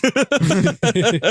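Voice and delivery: a quick amused burst of laughter; bright laugh. Real, not acted. Host laughing at the sucky change report. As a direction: laugh it off lightly.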